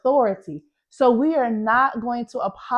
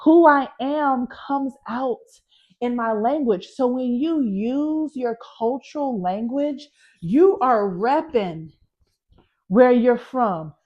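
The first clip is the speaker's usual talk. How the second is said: ordinary talk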